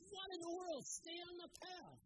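high, slowed exasperated command; angry rider. Acted. Balaam beating his donkey.

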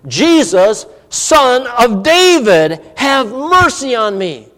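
loud, slow, drawn-out plea upward; calling out. Acted. Blind beggar crying out to passing Jesus, loud slow plea. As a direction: cry out to Jesus over the crowd. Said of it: "look at what he calls out".

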